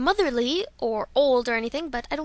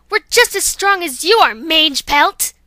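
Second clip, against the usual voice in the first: defiant shout; 3 semitones higher, 10 dB louder than usual. Acted. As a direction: throw Mange Pelt out like a name. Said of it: "I'm saying Mange Pelt like it's a name".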